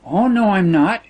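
put-on flustered girlish protest; hurried exclamation. A narrator's reading. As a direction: exclaim it hurriedly, pitch lifted. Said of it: "she exclaimed hurriedly".

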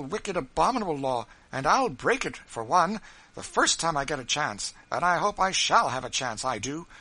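rising outrage against a wicked law; indignant outburst. Acted.

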